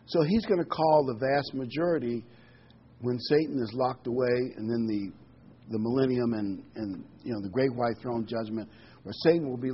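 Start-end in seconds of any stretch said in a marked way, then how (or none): none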